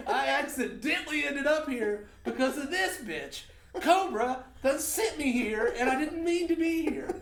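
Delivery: high, loud mock outrage through laughter; exasperated protest. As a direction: plead your case at a hammed-up high pitch. Acted protest to border guard.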